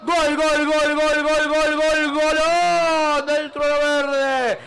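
long stretched, loud goal call; goal scream. Real, not acted. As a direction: roar out the goal, holding the vowels. Goal scored, commentator's goal call.

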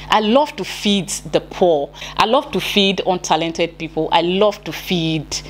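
normal talking voice

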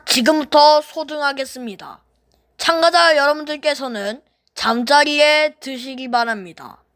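loud formal loudspeaker announcement; PA announcer. Acted. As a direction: announce it over the speakers. Lights-out PA announcement.

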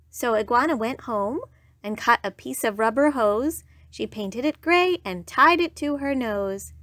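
plain reading voice

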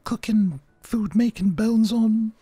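put-on high, thin old lady voice; high comic granny; grandma. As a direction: play a weary grandma in a high voice. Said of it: "rest my weary chef".

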